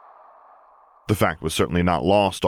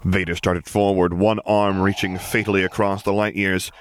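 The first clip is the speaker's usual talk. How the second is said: usual speaking voice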